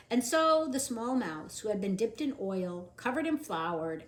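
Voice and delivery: usual speaking voice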